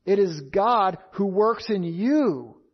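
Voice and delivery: "It is God who works in you" said slowly, "you" pressed down at the end with a falling close; slow emphatic stress. Shown as a demonstration. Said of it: "Notice the emphasis of verse thirteen".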